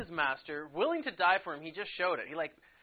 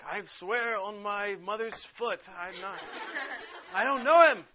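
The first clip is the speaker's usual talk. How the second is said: Peter swearing his denial, slow then shouting into a laugh; mock swearing; 5 semitones higher, 8 dB louder than usual. Teacher plays Peter denying Jesus, mock swearing. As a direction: ham up a frantic oath. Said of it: "like, swearing".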